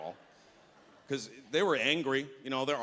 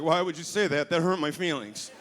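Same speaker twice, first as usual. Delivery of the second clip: hurt reply; 4 semitones higher, 4 dB louder than usual. Retold or imitated. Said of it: "I was like".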